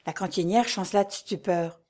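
plain reading voice

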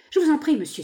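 high-pitched entreaty; pleading. A narrator's reading. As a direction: beg him urgently. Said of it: "supplia à son tour la jeune femme".

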